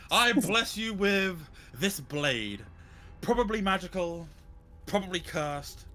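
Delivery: high, loud ceremonial proclamation, slowly drawn out; grandiose showman. Acted. Showman kneeling to gift a blade to his companion, grandiose. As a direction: bestow it grandly, milking each phrase. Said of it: "wanting to be ever the showman".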